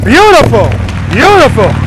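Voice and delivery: loud, excited praise. Real, not acted. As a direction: cheer the rider on loudly. Praising a rider from afar.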